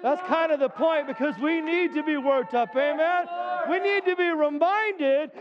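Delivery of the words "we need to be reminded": higher, slow, ringing declaration; preaching at full force. Real, not acted. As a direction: proclaim heaven as home. Preaching heaven is home.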